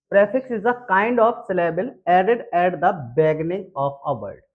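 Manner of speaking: reads the definition very slowly, word by word; slow dictation. Shown as a demonstration. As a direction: dictate so students can write it down. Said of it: "dictates the definition slowly". (धीमे-धीमे लिखवाते हुए)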